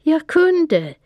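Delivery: high, loud triumphant call upward; loud shout. A narrator's reading. Dylan calls after Panter flying to the moon, loud triumphant shout. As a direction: shout it to the sky. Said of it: "högt ropade jag".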